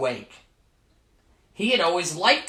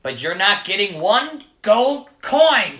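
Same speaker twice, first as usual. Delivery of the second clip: slow, loud, stubborn leprechaun refusal; angry and slow; 4 semitones higher, 7 dB louder than usual. Acted. Leprechaun refuses Michael slowly and angrily. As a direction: spell out each word in anger. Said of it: "his voice rising".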